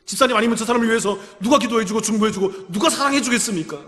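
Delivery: ordinary talk, nothing marked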